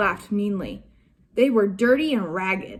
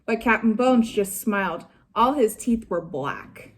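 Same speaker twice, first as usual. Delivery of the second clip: usual speaking voice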